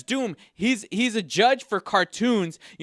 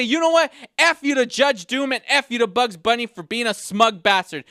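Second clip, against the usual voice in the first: loud angry send-off; 3 semitones higher than usual. Real, not acted. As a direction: spit out the catchphrase in full outrage. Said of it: "So that's why I say".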